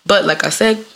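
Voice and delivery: ordinary talk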